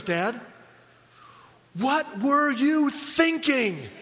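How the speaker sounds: slow, deadpan question with a laugh breaking in; mock-serious question. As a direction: play the grave grown daughter, drawn out. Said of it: "in all seriousness and she goes".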